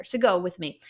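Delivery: ordinary talk, nothing marked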